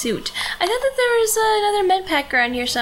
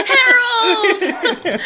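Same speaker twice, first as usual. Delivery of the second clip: laughing shout; 8 semitones higher, 4 dB louder than usual. Acted. Friends laughing at in-game NPC.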